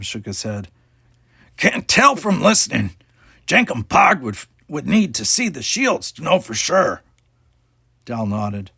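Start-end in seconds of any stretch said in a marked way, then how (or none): 1.6-7.0 high, loud anxious creature voice talking of himself; an alien who is scared and unsure; fretful alien. A narrator's reading. Jankum's alien voice held.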